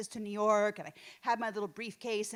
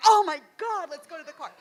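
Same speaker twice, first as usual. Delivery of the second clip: put-on mortified kids; 7 semitones higher, 9 dB louder, faster than usual. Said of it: "and they're like".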